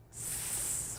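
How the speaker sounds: long unvoiced "s" hiss like a snake. Shown as a demonstration. A teacher showing the snake sound, long hissed s. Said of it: "It sounds like".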